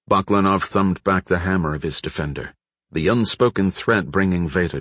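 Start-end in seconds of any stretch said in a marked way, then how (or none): none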